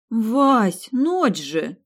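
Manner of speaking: loud groaning protest. Acted. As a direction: groan it like you're half asleep. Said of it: "простонала я".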